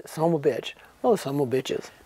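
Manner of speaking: lightly imitated low-voiced swearing; a hint of his low, comic cursing. Showing how he always swore, light low impression. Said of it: "He loved to swear".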